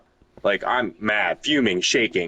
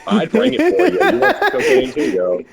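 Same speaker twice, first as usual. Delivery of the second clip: much higher and louder, laughing through it; 11 semitones higher, 6 dB louder than usual. Real, not acted. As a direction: crack up while teasing. Joking offer, laughing.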